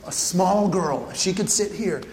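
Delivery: usual speaking voice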